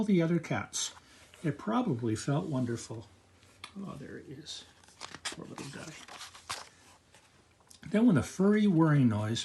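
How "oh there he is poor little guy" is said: soft, near-whispered pity; hushed sympathetic aside. Real, not acted.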